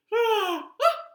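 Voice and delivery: high, loud gasp of delight; amazed gasp. A narrator's reading. Toad seeing his sprouted seeds, amazed gasp.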